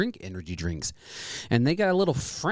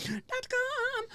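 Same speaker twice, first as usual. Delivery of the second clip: squeaky echo; 4 dB quieter than usual. Acted. Echoing co-host in high voice.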